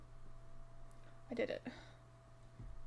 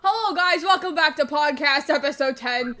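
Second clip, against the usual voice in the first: loud, high podcast intro shout; aggressive greeting; 3 semitones higher, 10 dB louder than usual. Real, not acted. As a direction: blast the welcome at full volume. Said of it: "That was so aggressive".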